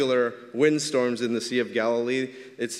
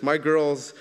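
ordinary delivery

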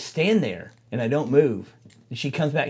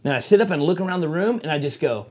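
usual speaking voice

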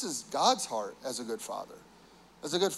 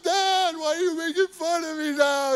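very high, tearful wail; hurt crying child; 12 semitones higher, 4 dB louder than usual. Retold or imitated. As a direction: cry out like a hurt, teased boy. Son hurt by teasing.

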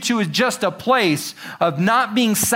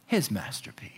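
hushed, breathless close; soft unvoiced whisper; 9 semitones lower, 12 dB quieter than usual. Real, not acted. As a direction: whisper it reverently. Whispered climax.

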